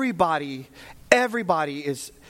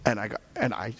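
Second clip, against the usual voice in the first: ordinary delivery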